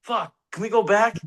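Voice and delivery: high-pitched, flustered replay of his plea; mock panicked plea. Actor pleading with the crew to go back, mock panicked. Acting out asking to redo takes.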